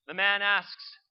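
ordinary delivery

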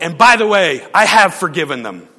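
loud, pointed mock yell of the final word; retold yell. Pastor retelling the angry woman's parting shot, loud mock yell. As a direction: fling the last line out loud and sharp. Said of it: "after yelling at me".

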